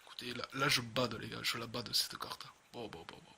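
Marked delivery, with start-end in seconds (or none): 2.7-3.3 whispering. Real, not acted. Calming himself after the reveal.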